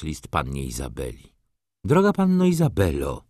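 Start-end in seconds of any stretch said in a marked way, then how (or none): 1.8-3.2 letter voice of baroness. A narrator's reading. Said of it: "pisała baronowa".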